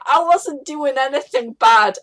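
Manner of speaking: hammed-up innocent rogue voice; goofy rogue. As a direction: play it like a caught-out goofball. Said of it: "the rogue going".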